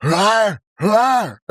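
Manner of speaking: loud, high mock roar; roaring sneeze. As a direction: bellow it like a lad sneezing. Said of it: "the lads are like".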